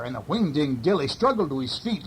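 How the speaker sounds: plain reading voice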